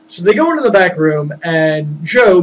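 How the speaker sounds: ordinary talk, nothing marked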